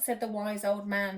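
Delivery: ordinary delivery, nothing marked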